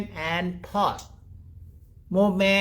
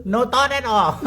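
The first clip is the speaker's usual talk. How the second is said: raised, louder voice full of laughter; laughing; 6 semitones higher, 5 dB louder than usual. Real, not acted. Teacher joking with students about angry wives, laughing. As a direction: call it out through a real laugh.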